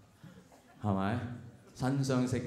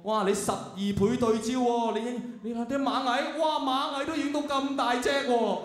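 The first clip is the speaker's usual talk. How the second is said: high-pitched, over-excited marvelling; mock amazement; 9 semitones higher than usual. Photographing ants in the park, hammed-up wonder. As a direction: gush as if the zoom is a miracle. Acted marvelling at phone zoom.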